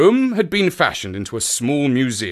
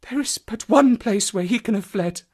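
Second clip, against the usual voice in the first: woman's voice; 10 semitones higher than usual. A narrator's reading. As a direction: answer with resolve. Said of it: "she answered".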